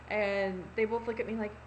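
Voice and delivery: ordinary talk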